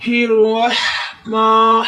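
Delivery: الرحمن with the ha from the throat, slow; throaty ha. Shown as a demonstration. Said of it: "ہا کی آواز حلق سے نکالیں گے". (الرحمن میں حلق سے ہا)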